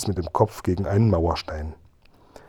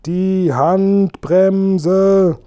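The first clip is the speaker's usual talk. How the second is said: much higher, louder, very drawn-out shout; yelled louder; 9 semitones higher, 5 dB louder, much slower than usual. A narrator's reading. Kasperl and Seppel shout again so he finally hears, very slow loud yell. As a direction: stretch the shout out, fully yelling. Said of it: "riefen Kasperl und Seppel".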